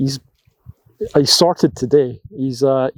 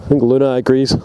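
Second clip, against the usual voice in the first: ordinary talk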